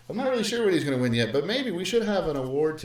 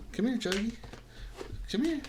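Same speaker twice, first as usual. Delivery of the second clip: much higher, coaxing call; calling the dog; 11 semitones higher, 4 dB quieter, faster than usual. Real, not acted. Teacher calling his pug over, high coaxing call. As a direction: coax the pug over with a lifted voice.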